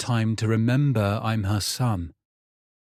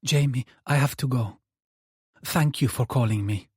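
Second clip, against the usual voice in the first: mother's voice; 3 semitones higher than usual. Acted. Mother ending the call.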